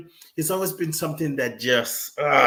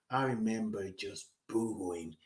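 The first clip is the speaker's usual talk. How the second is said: soft, breathy fading to a whisper; hushed tearful memory; 5 semitones lower, 12 dB quieter than usual. Retold or imitated. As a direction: drop to a whisper recalling tears. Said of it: "boohooing, crying".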